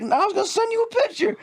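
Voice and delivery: very high, put-on pleading imitation; mock panicked excuse. Caught man explaining to his wife, mock high-pitched panic. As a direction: squeak out a comic excuse.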